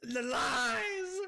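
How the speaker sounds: slow, high wail of betrayal; hammed-up outraged shouting; screaming. Real, not acted. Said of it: "That scream just made Summer jump".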